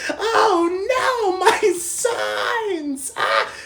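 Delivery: high, slow, drawn-out scream; mock shriek. Girl seeing her signs crushed, hammed-up wail. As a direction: imitate a wailing, distraught girl.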